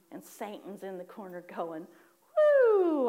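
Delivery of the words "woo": high, loud, drawn-out woo; gloating whoop. Retold or imitated. Satan celebrating in the corner, with a high gloating whoop. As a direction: whoop like a gleeful villain.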